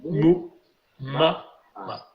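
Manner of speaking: slow, separated syllables with held vowels; récitation modèle. Shown as a demonstration. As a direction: give each syllable its own beat for repeating. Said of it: "donc on recommence". (récitation lente et détachée)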